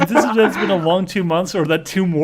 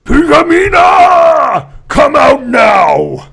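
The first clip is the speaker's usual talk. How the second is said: very loud, high, drawn-out yell; furious shout; 9 semitones higher, 10 dB louder, much slower than usual. Acted. Character summoning Pinkamena in a story, full drawn-out shout. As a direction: bellow it out, stretching every word.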